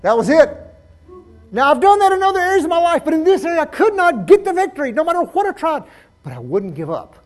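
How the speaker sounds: high, loud, insistent sermon; full-force preaching. Real, not acted. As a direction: exhort the congregation with full voice.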